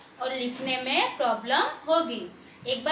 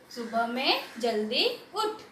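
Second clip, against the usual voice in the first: the sentence read at an easier, quicker pace; quicker joined reading. Shown as a demonstration. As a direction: read it through more connectedly. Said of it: "फिर उसे जल्दी से पढ़ना सीखो". (थोड़ा जल्दी, जोड़कर पढ़ना)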